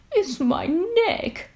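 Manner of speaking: giraffe. Acted. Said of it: "said the giraffe".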